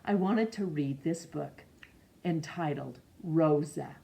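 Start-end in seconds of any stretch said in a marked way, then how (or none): none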